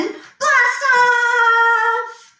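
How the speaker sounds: high excited shout. Acted. Countdown ends in launch.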